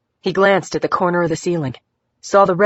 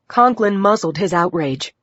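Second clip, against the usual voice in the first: ordinary delivery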